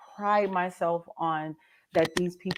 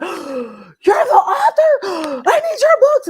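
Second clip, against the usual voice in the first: slow, high, loud squeal with gasps; astonished fan; 12 semitones higher, 9 dB louder, much slower than usual. Retold or imitated. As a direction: imitate a fan shrieking in sudden recognition. Fan realises, retold with gasps.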